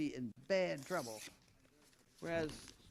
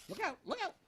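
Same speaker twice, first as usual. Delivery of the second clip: sudden high warning shout; quick high alarmed call; 12 semitones higher, 4 dB louder, faster than usual. Real, not acted. Warning as something falls.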